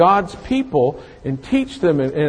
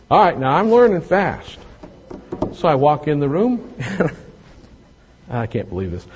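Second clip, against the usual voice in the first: ordinary talk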